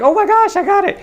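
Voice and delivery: put-on high squealing girly voice, thrilled; excited girl. Girl guessing his name, squealing with delight. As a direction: squeal it like she just won. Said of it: "And when the girl's like".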